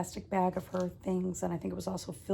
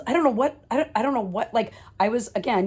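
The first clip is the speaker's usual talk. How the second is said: ordinary talk, nothing marked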